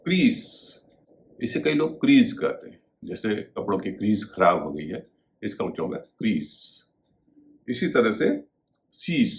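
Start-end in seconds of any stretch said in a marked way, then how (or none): none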